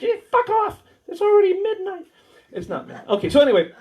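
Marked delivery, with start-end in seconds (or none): 0.0-2.1 much higher mock patient voice; grumpy patient. Patient mocked for snapping at the doctor, high mock whine.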